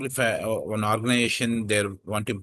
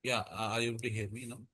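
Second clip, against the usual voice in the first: quiet; 9 dB quieter, faster than usual. Real, not acted. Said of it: "A little bit better".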